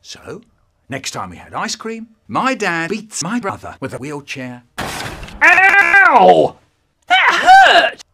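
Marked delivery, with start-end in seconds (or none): 4.8-8.0 high, loud yelp of pain; pained shout. Acted. Brother yelling after being hit. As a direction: cry out as if just struck.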